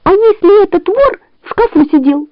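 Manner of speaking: high, anxious fox voice; worried fox. A narrator's reading. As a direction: fret up high.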